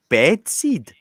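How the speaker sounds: high, loud puzzled echo; baffled shopkeeper. Retold or imitated. Shopkeeper mishearing a customer, high loud puzzled mimicry. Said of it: "And she said".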